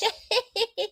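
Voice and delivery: high imitated giggle, trailing off; mock giggle. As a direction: giggle it like her, fading. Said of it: "And she just laughing".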